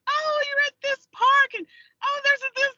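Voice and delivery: very high, loud chirpy voice; sing-out helpful directions, high and loud; helpful strangers. Retold or imitated. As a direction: imitate eager locals, pitch way up. Voices helpful women giving directions.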